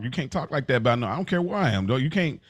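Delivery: ordinary talk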